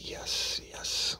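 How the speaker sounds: a quick, hushed, eager yes under the breath; whispered yes. Retold or imitated. Detective privately cheering as the suspect slips, whispered. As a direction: breathe it out as a secret yes to yourself. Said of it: "I'm like".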